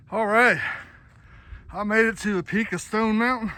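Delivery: panting after the summit climb; breathless, words between breaths; gasping for air. Real, not acted. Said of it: "I'm out of breath".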